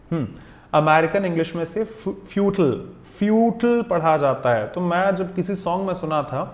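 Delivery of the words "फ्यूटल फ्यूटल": फ्यूटल with a short final tul, no long i; फ्यूटल said fyootul, American style; American pronunciation. Shown as a demonstration. Teacher giving students the American fyootul. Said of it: "American English में से फ्यूटल पढ़ा जाता है".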